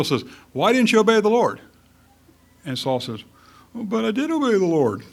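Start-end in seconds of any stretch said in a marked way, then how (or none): 0.5-1.6 high, loud accusing question; stern demand. Acted. Samuel confronting disobedient Saul, stern demand. 3.7-5.0 high, whiny protest; Will Forte's President Bush. Acted. Saul protesting to Samuel that he obeyed, whiny. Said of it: "weak impression of President Bush where he comes off as kind of whiny".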